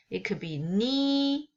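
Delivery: level high "ni"; first tone ni. Shown as a demonstration. Teacher listing the four Mandarin tones of ni. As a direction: hold "ni" high and flat. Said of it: "horizontal line on top".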